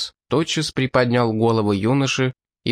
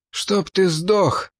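squeezed out slowly, higher, with effort; forced out; 9 semitones higher, much slower than usual. Acted. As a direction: force each word out through pain. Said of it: "выдавил Ридли".

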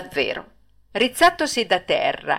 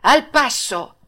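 called command, a touch higher; ringmaster's shout; 6 semitones higher, 3 dB louder, slower than usual. Acted. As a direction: crack out the order like a circus command. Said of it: "non gli gridò".

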